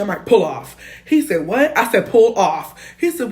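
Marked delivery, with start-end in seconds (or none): 0.2-0.8 loud, sharp command to go; urgent order. Retold or imitated. Telling her friend to flee the crash scene, loud urgent order. 1.5-1.8 high, loud puzzled question; confused what. Retold or imitated. The friend doesn't get why he should drive off, loud and confused. 2.1-2.8 louder, insistent command; repeated order. Retold or imitated. Repeating to the driver that he must leave, loud insistent order.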